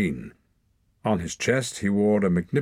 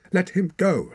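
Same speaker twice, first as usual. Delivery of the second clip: high, loud desperate shout; 12 semitones higher, 5 dB louder than usual. A narrator's reading. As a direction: shout while struggling to free him. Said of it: "shouted Torak".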